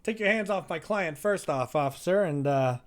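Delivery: high, loud commanding entrance; slick lawyer barging in. Acted. Lawyer storming into the interrogation to protect his client, loud commanding hey. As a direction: burst in and take control of the room. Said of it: "He goes".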